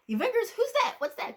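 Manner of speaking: high, baffled questions; confused surprise. Retold or imitated. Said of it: "they were all like".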